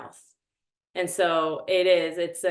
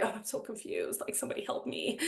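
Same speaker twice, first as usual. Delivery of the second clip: mock-helpless plea, hushed and much softer; tiny, put-on damsel-in-distress voice; theatrical helplessness; 12 dB quieter, faster than usual. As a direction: plead feebly, just for show. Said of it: "like, being helpless".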